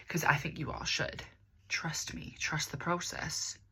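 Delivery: soft, slow conspiratorial whisper; hushed trust me. Acted. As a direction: lean in and share a secret. Whispered advice to viewers.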